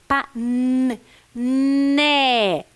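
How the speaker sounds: "Pa-, n, né" with a long humming n before the vowel; sounding out. Shown as a demonstration. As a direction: hold the n, then stretch out né. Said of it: "escrever a próxima sílaba". (prolongando o som do n em "né")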